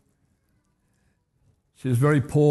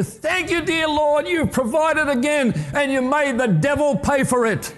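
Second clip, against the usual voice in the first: much higher, yelling praise and laughing; jubilant yell; 11 semitones higher than usual. Retold or imitated. Widow yelling back at the neighbour, laughing triumphant praise. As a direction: shout thanks, even more ecstatic. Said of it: "she just yelled out all the more".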